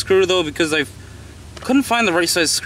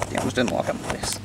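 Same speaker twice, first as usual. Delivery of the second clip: muttered under breath; 3 semitones lower, 8 dB quieter than usual. Real, not acted. Tripod slips, quiet curse.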